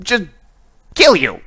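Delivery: high, loud, demanding witch; Lilith. Acted. Witch interrogating the one in armor about the elf, high angry shout. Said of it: "you can hear once again Lilith's voice".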